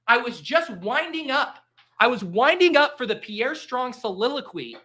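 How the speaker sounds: high-pitched, aggrieved protest; mock outrage. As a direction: whine that your pick was stolen. Mock outrage at losing pick.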